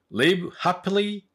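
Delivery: high, slow, separated English phrases; slow model reading. Shown as a demonstration. Teacher presenting idiom number two, slow high-pitched careful enunciation. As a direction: model each word slowly for learners.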